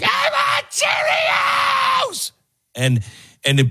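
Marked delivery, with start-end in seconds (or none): 0.0-2.3 very high, drawn-out mock screech; hammed-up preschooler tantrum; tantrumming toddler. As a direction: wail it like a small child melting down. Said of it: "three or four-year-old did it in a grocery store".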